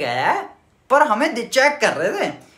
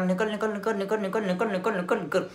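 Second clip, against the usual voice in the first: soft, under-the-breath "निकल" repeated; hushed urgent; 8 dB quieter than usual. Retold or imitated. Cadets softly urging a friend to slip away, hushed. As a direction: murmur "निकल, निकल" through a covered mouth. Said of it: "मुंह दबा के".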